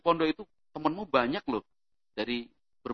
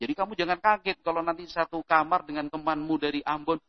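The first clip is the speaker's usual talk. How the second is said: ordinary talk, nothing marked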